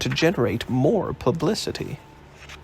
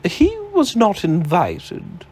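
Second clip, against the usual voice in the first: slow, higher, deliberate character voice; 7 semitones higher, 3 dB louder, slower than usual. A narrator's reading. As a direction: lay out the warning slowly, with weight.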